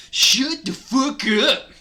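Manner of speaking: odd put-on voice. Reader improvises Cornelio Fuck's voice, odd shouting. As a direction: shout it slowly in an unplaceable silly voice. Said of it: "I don't know what voice that is".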